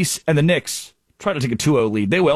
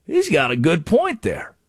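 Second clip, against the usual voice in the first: mock realization; 11 semitones higher than usual. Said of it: "I thought".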